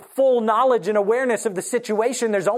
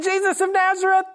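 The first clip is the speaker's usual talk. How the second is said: braced soldiers' shout; 11 semitones higher than usual. Acted. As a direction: shout it back, bracing yourselves. Soldiers braced, answer again.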